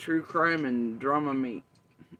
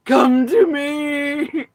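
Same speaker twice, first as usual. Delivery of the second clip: very slow, loud, high theatrical wail; hammed-up bad acting; 8 semitones higher, 14 dB louder, much slower than usual. Mocking someone's bad acting with a drawn-out loud wail. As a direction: overact it like a cheesy stage ghost. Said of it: "Bad acting, larp and shit".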